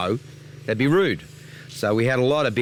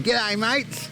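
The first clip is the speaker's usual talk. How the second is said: high, mock Aussie greeting as locals say it; imitating Vietnamese locals; 10 semitones higher than usual. Imitating Vietnamese locals greeting Australians, high and chirpy. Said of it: "So we got a lot of".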